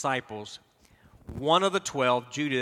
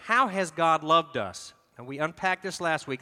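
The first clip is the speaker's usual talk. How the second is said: ordinary talk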